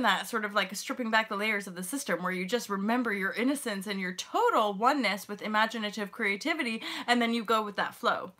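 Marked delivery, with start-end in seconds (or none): none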